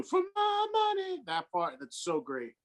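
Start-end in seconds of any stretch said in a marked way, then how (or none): 0.0-1.2 very high, slow falsetto line; Paul's vocal. Retold or imitated. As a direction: sing it way up like a rock frontman. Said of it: "that Jean Paul vocal thing too".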